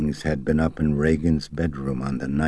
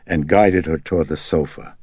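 ordinary delivery

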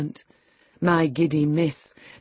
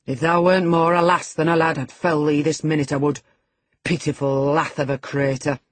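louder angry Yorkshire threat; angry retort; 5 dB louder than usual. A narrator's reading. Hareton to Linton, louder angry threat. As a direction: threaten him, fuming. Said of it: "retorted the angry boor".

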